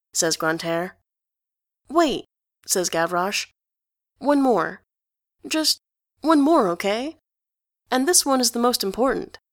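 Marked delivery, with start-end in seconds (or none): 1.9-9.4 high, pushy and insistent; eager pleading kid. A narrator's reading. Gavroche begs Enjolras for one more question, eager. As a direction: beg for just one more.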